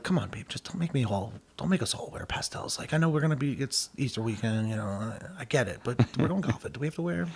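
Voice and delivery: softly voiced, pleading with his wife; pleading husband. Husband pleads with wife over pastels.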